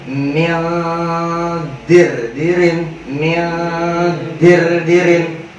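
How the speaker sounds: mildir and dirin with a nasal nun sound, the wrong way; wrong nasal reading. Shown as a demonstration. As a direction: show the mistaken nasal mildir. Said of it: "makhrajnya dapat nun matinya".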